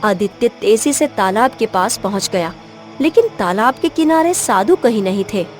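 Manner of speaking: ordinary delivery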